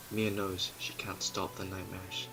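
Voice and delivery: ordinary delivery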